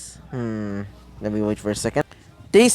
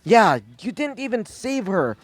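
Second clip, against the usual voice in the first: higher-pitched, exasperated telling-off; indignant scolding; 5 semitones higher than usual. Acted. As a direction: scold with pitch raised, volume held. Izuku scolds All Might.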